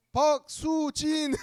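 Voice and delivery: high, loud, slow winner reveal; hyped giveaway announcement. Acted. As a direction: shout the winner's name with glee.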